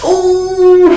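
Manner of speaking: sharp high ooh breaking into a laugh; loud pained ooh then laughter; pained yelp into laugh. Real, not acted. Pain while waxing armpit.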